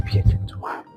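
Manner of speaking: Be a gentleman half whispered; soft whispered take. Shown as a demonstration. Rehearsing alone, a soft whisper. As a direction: mutter Be a gentleman to yourself. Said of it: "Like this".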